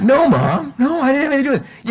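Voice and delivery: put-on high, fast, loud kid's denial; imitated son blurting out excuses; protesting boy. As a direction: protest like a boy caught by his mom. Boy protesting to accusing mother.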